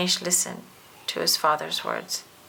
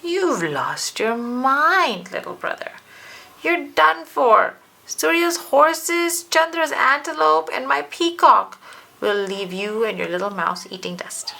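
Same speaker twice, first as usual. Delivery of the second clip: laughing scorn; 6 semitones higher, 4 dB louder than usual. Acted. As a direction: sneer at him, stretching each word. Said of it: "Karthik laughed at him".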